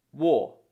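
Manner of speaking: "war" said loud and clear. Shown as a demonstration. Teacher modelling the first syllable of walking as "war". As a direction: sound out "war" as the start of walking. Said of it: "pronounced very similar to".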